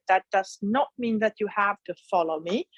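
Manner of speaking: usual speaking voice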